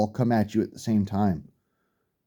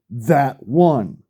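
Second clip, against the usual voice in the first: ordinary talk, nothing marked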